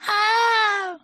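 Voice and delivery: put-on very high, loud childlike cry; excited. A narrator's reading. Roo reacting to Eeyore's news, excited. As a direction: pitch it right up, thrilled. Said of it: "said Roo excitedly".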